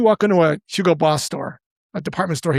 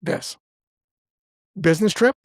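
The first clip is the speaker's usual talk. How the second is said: calm guess; 3 semitones higher than usual. Retold or imitated. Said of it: "calmly said".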